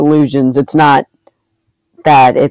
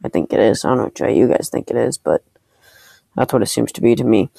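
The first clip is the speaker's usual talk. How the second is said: normal talking voice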